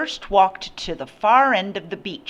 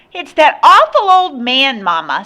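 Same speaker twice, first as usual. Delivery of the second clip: put-on high, loud complaining child; bratty child's voice; 8 semitones higher, 10 dB louder than usual. A narrator's reading. Boy reporting the visitor to his mother, loud and bratty. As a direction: holler back as a disgusted child.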